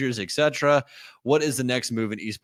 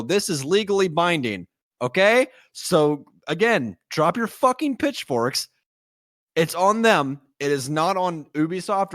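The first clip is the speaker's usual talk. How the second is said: heated rant; 7 semitones higher than usual. Real, not acted. Ranting at angry fans.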